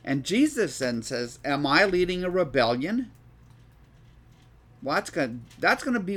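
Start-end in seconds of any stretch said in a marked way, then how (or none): 0.0-3.1 louder, projected Bible reading; scripture reading aloud. Acted. Teacher reads the arrest story to the class, projected scripture reading. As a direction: read the passage out emphatically.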